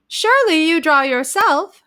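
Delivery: high, loud, gushing society lady; eager hostess. A narrator's reading. Mrs Markland gushing at the young bride. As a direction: press the question brightly. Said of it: "she asked".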